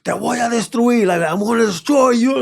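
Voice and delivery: high-pitched mock threat; imitated menacing threat. Attacker at the hotel door, mock threatening call.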